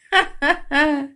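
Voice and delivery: high acted giggle; short burst of high laughter. Mistress reacting to flattery, high giggle.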